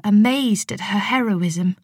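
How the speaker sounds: ordinary delivery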